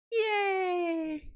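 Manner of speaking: high happy cheer. Real, not acted. Self-cheer.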